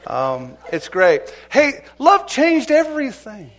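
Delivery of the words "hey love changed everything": emphatic proclaim. Real, not acted. Preacher proclaiming sermon theme.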